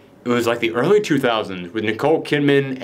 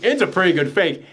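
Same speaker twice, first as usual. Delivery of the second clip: ordinary talk, nothing marked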